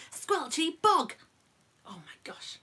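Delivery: ordinary delivery, nothing marked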